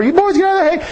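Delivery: put-on high-pitched old grandma calling out; grandma yelling. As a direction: imitate grandma hollering from the edge of the field. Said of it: "She stood out there yelling".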